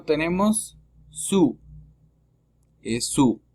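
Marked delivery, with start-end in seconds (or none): 1.1-1.6 French sous as "su", open U; silent final S. Shown as a demonstration. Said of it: "La S es muda". 3.1-3.4 "su" with OU as an open U. Shown as a demonstration. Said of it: "la O con U es una U abierta".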